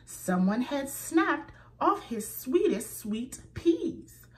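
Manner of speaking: ordinary talk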